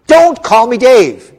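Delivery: insistent. Acted. Insisting on his name.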